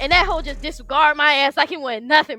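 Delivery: high-pitched, pained venting through tears; real tearful distress. Real, not acted. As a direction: pour out hurt and rage, voice pitched high. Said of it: "I'm crying because in my mind".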